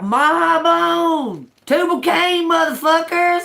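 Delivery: high, loud holler. Acted. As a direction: belt it out like a war cry. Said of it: "How y'all like me hollering that".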